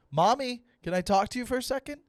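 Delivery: put-on high-pitched child calling Mommy; pleading kid. Son asking mommy for a talk, high kid voice. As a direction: pipe up like a kid wanting attention. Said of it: "that's how I spoke to my mom".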